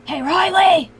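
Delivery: loud hushed call; whisper yelling. Acted. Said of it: "Because I'm whisper yelling".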